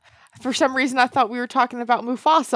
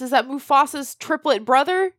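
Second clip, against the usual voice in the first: ordinary talk, nothing marked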